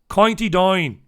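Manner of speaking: put-on loud County Down accent, vowels bent to "coynty dine". Host attempting the accent a comedian does well, exaggerated County Down accent. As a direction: have a go at a broad County Down accent. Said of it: "does a brilliant County Down accent".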